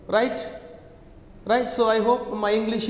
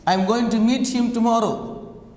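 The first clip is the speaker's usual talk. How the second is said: ordinary delivery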